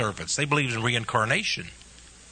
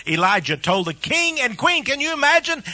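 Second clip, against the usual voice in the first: ordinary delivery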